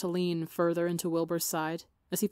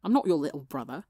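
bit out; 3 semitones lower, 3 dB louder than usual. Acted. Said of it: "Tommy bit out".

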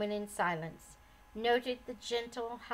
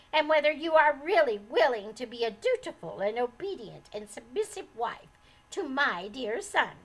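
higher, louder haughty lecture; sharp scolding; 3 semitones higher, 7 dB louder than usual. A narrator's reading. Old lady berating her son's wife, loud and sharp. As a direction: scold her like a stern mother-in-law. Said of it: "snapped the old lady".

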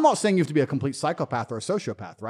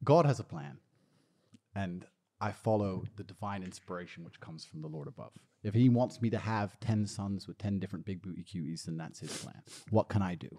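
low, hushed, deadpan piety; mock-pious solemnity; 6 semitones lower, 10 dB quieter than usual. Answering about kids as the Lord's vessel, mock-pious solemnity. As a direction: lower and soften it like a humble servant.

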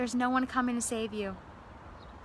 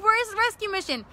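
desperate call; 9 semitones higher, 4 dB louder than usual. Retold or imitated. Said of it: "He's like".

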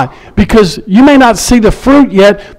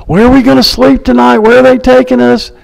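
mock complaining; 5 semitones higher than usual. Bus passengers in Africa asking about tonight, mock complaining. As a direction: imitate fretful complainers. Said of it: "Everybody's complaining".

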